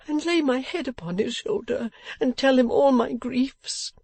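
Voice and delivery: high, distraught tearful pleading; passion of tears. A narrator's reading. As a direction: beg desperately through tears, pitched high. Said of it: "starting up in an incoherent passion of tears".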